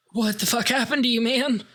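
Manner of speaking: high, rapid appalled outburst; mock horrified disbelief. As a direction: blurt it out as if aghast. Acted horrified viewer reaction.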